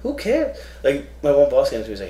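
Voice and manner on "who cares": high-pitched incredulous retort; mock outrage. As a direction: squeak out the disbelief. Mocking his complaining coworkers.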